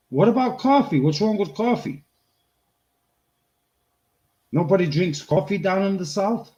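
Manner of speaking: high, exasperated disbelief; baffled that nobody drinks coffee; incredulous. Retold or imitated. Recalling the gas station, incredulous and high.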